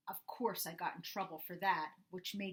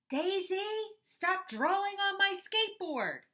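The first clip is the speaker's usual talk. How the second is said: high, loud furious yell at sister; angry yell; 13 semitones higher, 8 dB louder than usual. Acted. Brother yelling at his sister over the skateboard, angry yell. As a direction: shout it at her like a fed-up brother.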